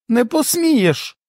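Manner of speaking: high, slow, indignant forbidding; forbidding cry. A narrator's reading. Wife forbidding husband to go, high indignant cry. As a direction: stop him cold.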